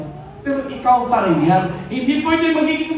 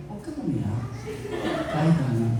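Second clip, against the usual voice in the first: much lower and softer "Huwag kang umiyak", tender; gentle try; 11 semitones lower, 7 dB quieter than usual. Shown as a demonstration. Pastor trying the gentle style his wife suggested, hushed and tender. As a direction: try the sweet motherly version, hushed. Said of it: "Jai, ganun".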